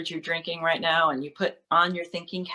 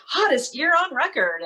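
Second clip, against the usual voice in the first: higher, mock-alarmed news headline; 10 semitones higher, 4 dB louder than usual. Performer mimicking the news on climate, mock-alarmed headline voice. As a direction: parody a breathless headline reader. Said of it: "the news is always saying things like".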